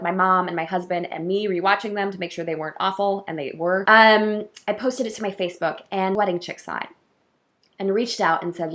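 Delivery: ordinary talk, nothing marked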